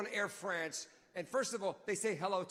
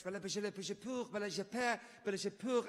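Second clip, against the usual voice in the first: fast fake French babble; mock French gibberish; faster than usual. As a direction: rattle off nonsense French. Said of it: "just speaking French".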